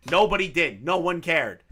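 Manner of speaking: normal talking voice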